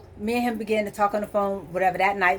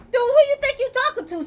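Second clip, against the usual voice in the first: high, indignant disbelief; incredulous outrage; faster than usual. Retold or imitated. Woman retelling her high, indignant comeback to the insulting man. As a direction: challenge him, who does he think he is. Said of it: "I'm like".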